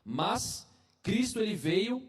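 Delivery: earnest sermon delivery, rising emphasis; preaching. Acted. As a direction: preach it to the congregation.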